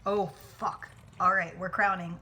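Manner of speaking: ordinary talk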